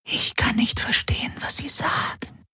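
soft, unvoiced-sounding whisper of the Helix. A narrator's reading. The Shadow Helix cannot understand the aliens, whispered. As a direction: whisper it very softly. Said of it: "flüsterte die Schattenhelix".

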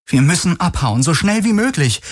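high, urgent appeal, climbing in pitch. A narrator's reading. Kirai urging the captain to flee, urgent. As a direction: press anxiously to get away. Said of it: "drängte Kirai".

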